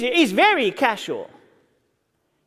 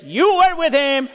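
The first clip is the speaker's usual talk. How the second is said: slow, louder insistent accusation; emphatic accusation; 5 semitones higher, 5 dB louder than usual. Retold or imitated. Servant girl insisting to Peter, slow and louder. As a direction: press it on him, louder and slower. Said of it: "And the girl is emphatic".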